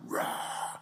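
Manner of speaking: soft, breathy mock roar; imitated lion roar. Preacher imitates the lion backing God's commands, hushed breathy roar. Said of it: "like the lion just going".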